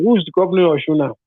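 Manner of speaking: ordinary talk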